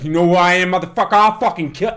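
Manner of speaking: retold angry yell; angry threat. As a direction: bark it like a furious gangster. [name] threatening man in story.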